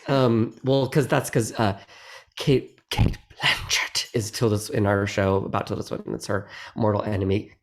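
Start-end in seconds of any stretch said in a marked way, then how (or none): 2.4-4.1 unvoiced rasp of a dirty word; mock cursing under the breath; like a swear word. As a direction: whisper the name like a curse. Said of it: "if she needs to say a bad word".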